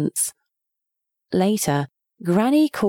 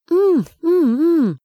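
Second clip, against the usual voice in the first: louder muffled hums through a stuffed mouth; mouth full; 8 semitones higher, 4 dB louder, slower than usual. A narrator's reading. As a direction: hum with cheeks full of pudding. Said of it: "so much pudding in his mouth that he couldn't swallow".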